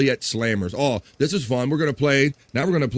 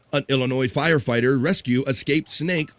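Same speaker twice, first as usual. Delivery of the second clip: usual speaking voice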